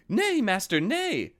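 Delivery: high timid lamb. A narrator's reading. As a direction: plead in a high little lamb voice. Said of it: "said Lambikin".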